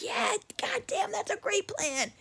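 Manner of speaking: high, hushed, slow-drawn mock enthusiasm; excited. Little brother all for the prank, excited. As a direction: imitate a thrilled kid, high but soft. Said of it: "he was all excited".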